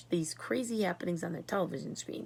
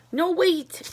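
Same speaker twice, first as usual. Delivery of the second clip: put-on high, loud boy's call; urgent cry; 10 semitones higher, 8 dB louder than usual. A narrator's reading. Charlie calling out as he floats helplessly, high and loud. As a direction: call out to stop him. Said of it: "cried Charlie".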